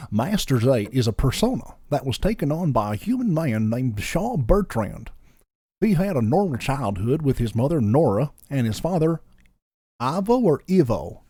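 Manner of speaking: put-on lilting Southern storyteller with a Georgian accent; lazy Georgian accent. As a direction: narrate in a lazy Georgian accent with a touch of Goofy. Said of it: "most lazy Georgian accent possible".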